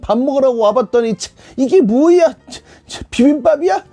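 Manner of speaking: put-on high, whiny complaining child voice; grumpy kid. Pastor mimics a child griping about stepping in poop. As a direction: pout and gripe like a sulky kid. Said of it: "이런 친구들 있지는 않는지".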